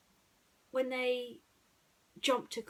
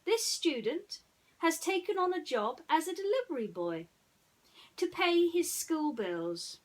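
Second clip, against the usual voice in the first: lightly voiced Dawson; 9 semitones higher, 4 dB louder than usual. Dawson explains the case.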